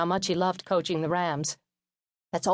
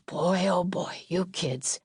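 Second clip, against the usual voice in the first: weary father; much slower than usual. Retold or imitated. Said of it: "and said".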